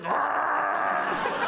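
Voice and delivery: high, drawn-out mock groan; hammed-up wordless cry; imitated wordless yell. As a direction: let out a long inarticulate yell. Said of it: "Helen Keller's like".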